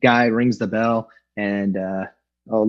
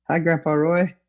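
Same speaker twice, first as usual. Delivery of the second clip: lightly voiced little girl, higher; baby's greeting; 6 semitones higher than usual. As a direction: speak for the shy daughter, a touch higher. Voicing baby daughter's greeting.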